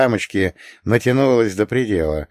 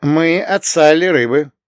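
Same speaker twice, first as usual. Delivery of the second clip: higher, slow, clear report; 7 semitones higher, 4 dB louder than usual. A narrator's reading. Errand runner reports to the madam, reporting tone. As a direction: announce it like reporting in. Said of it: "отрапортовал я".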